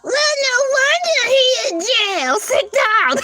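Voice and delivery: high, sassy put-down; high scolding sass. Real, not acted. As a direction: tell him off. Scolding reaction.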